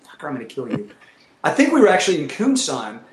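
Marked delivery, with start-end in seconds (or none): 0.1-0.9 muttered mock threat. Threatens friend at trough.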